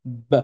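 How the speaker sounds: "b" sounded alone with no real vowel; lone "b" sound, zero vowel; vowelless consonant. Shown as a demonstration. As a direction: clip "b" to the bare consonant. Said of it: "un petit rond au-dessus".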